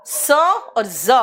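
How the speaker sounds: स and ज drawn out slowly; slow teacher listing; very slow demo. Shown as a demonstration. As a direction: slowly contrast the two sounds. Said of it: "तीन उच्चारण निकलता है".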